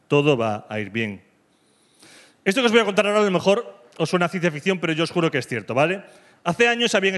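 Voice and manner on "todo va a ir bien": low, slow, soothing words; tender reassurance. Retold or imitated. Someone comforting us, slow, low and gentle. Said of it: "nos diga".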